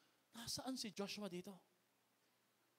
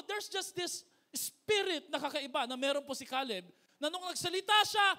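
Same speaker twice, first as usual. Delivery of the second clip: normal talking voice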